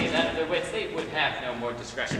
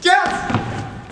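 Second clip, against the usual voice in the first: excited; 11 semitones higher, 6 dB louder than usual. Acted. Bottom delighted to get part.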